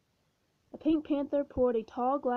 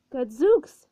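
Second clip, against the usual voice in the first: louder startled gasp of a word; startled exclamation; 10 dB louder than usual. Acted. Pink Panther startled by the attic noise, loud exclamation. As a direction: blurt it out, caught off guard. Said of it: "he said".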